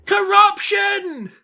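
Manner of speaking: high, slow ranting yell; angry townsfolk shout. Acted. Townsman shouting at the preacher about corruption, slow ranting yell. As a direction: bellow it like a street preacher. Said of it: "you very quickly get somebody saying".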